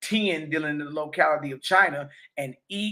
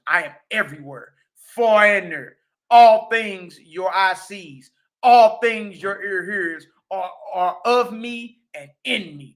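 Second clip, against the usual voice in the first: slow, loud, deliberate scripture reading; booming preacher voicing the Creator's words; solemn declamation; 6 dB louder, slower than usual. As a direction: proclaim each phrase with weight.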